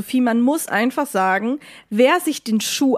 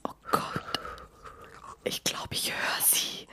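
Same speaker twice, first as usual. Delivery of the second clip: whisper; 15 dB quieter than usual. Acted. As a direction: whisper so they can't hear you. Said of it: "leise jetzt".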